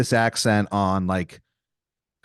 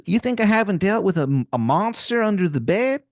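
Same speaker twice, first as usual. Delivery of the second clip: higher-pitched, hammed-up Maryland accent; Wizard of Baltimore, bad Maryland accent; 8 semitones higher than usual. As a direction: put on a terrible Maryland twang. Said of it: "this man's accent is something".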